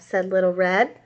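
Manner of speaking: put-on lively read-aloud for young kids; storybook read-aloud. A narrator's reading. Teacher reading a story to her class, lively storytelling. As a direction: read it out like a children's picture book. Reads picture book to class.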